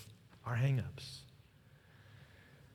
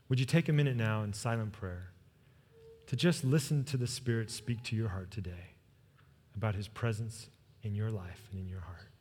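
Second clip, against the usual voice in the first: soft, lowered pastoral voice; invitation to prayer; 6 semitones lower, 8 dB quieter than usual. Real, not acted. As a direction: quietly lead the congregation into silent prayer. Invites congregation into silent prayer.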